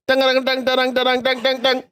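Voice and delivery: sung high "dang dang dang" news theme; news jingle imitation. Shown as a demonstration. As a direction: hum the news intro as dang dangs. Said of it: "Give us ... a newsread".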